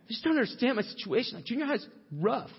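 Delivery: high-pitched mock teenage complaint; whining junior-high kid.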